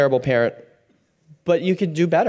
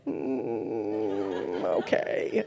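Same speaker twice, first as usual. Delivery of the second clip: soft, almost unvoiced mm okay; patient resigned murmur; 6 semitones lower, 9 dB quieter, much slower than usual. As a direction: humour a rambling toddler quietly. Parent enduring toddler's long story.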